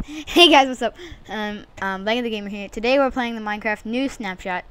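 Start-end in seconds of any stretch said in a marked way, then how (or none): 0.1-0.9 loud, high hey; shouted hey guys; hyped greeting. Acted. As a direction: burst into the intro. Restarting intro loudly.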